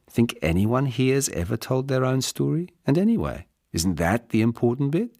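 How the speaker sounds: a hint of a forthright young woman, challenging him; Caroline's voice.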